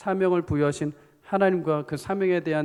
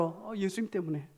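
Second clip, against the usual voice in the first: much softer, easy reply; gentle answer; 2 semitones higher, 9 dB quieter than usual. Shown as a demonstration. Preacher modelling a believer's answer to a curious friend, soft and gentle. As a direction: answer softly and simply after a little "oh". Right answer, against the scowling one.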